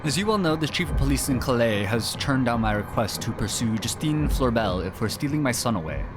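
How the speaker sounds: high, loud theatrical reading; dramatic letter reading. Acted. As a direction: read the letter like a stage part. Reads the in-game letter aloud.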